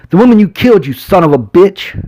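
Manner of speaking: very high, loud furious yell; enraged sheriff screaming at the suspect on the floor. A narrator's reading. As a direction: scream it down at him. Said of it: "He screamed down at me".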